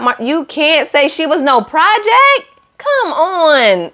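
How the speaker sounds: high, slow disbelieving protest; dramatic come on at the TV; exasperated scolding. Real, not acted. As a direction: scold him like he should know better. Scolding Marshall on the show.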